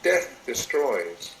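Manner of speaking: usual speaking voice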